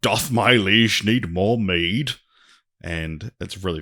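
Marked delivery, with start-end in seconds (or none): 0.0-2.1 slow, loud mock old-English servant voice; drawn-out olde-worlde courtier, loud and slow; weird medieval servant. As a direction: ham up a fawning squire offering mead. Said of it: "kind of a weird dude".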